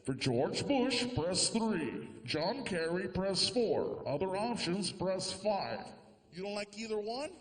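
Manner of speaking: a hint of a recorded phone menu; automated hotline. Presidential hotline recording listing options, measured.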